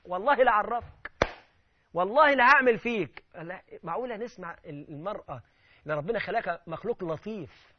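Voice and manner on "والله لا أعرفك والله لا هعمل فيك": louder threats, pitched up; imitating a yelling wife. Preacher voicing a wife raising her voice at husband, loud. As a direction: bark the oaths at him.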